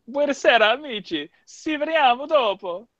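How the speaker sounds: high, theatrical Italian greeting; showy Italian. Shown as a demonstration. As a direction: ham it up like a grand Italian host. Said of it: "I know how to swear in a lot of languages".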